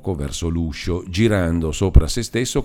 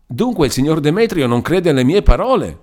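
high, louder indignant shriek; shrieking old man; 8 semitones higher, 5 dB louder than usual. A narrator's reading. Old man rising with his stick: indignant shriek. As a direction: shriek it out, offended. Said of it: "strillò di nuovo il vecchio".

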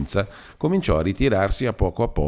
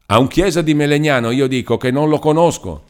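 spiteful retort; 7 semitones higher, 4 dB louder than usual. A narrator's reading. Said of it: "a lui di ripicco l'altro".

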